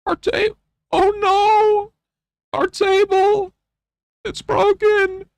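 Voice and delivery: put-on very high, drawn-out babyish cry; squealing mock-tragic wail; mock toddler wail. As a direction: wail like a kid whose toy broke. Mock wail over broken table.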